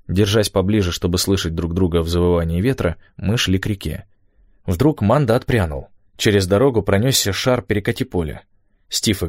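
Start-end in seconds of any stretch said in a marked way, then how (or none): none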